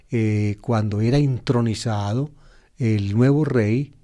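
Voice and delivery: normal talking voice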